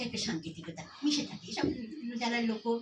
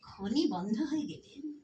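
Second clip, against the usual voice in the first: slow, deliberate phrasing; slowly; slower than usual. Shown as a demonstration. Lecturer slowing down for the closing point, deliberate. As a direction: lay out each word of খনি বন্ধ হয়ে গেলে slowly. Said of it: "আস্তে আস্তে বলছি".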